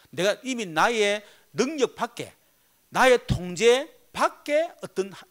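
ordinary talk

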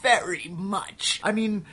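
drawn-out, seething emphasis; exaspéré puis furieux. Real, not acted. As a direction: stretch the words out in sheer exasperation. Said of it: "this game pissed me off".

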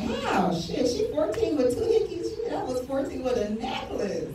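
high, incredulous retort; mock outrage. Comic mom arguing back with the gossips, high and incredulous. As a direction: squeak it out in disbelief. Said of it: "I'm like".

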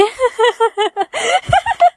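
long, high, helpless laugh; sick, unstoppable giggling; uncontrolled laughter. Acted. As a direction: let the laughing fit take over. Friend catches laughing disease.

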